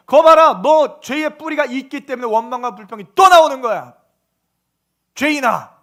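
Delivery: loud, forceful acted rebuke; God condemning. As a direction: thunder the verdict as God. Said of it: "라고 말하는 거예요". (죄인을 꾸짖는 하나님의 큰 호통)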